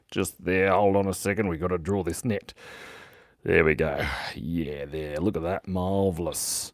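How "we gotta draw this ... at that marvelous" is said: murmured to self. Real, not acted. Murmuring to self while drawing.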